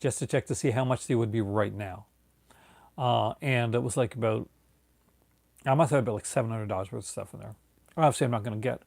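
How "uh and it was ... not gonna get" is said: ordinary talk, nothing marked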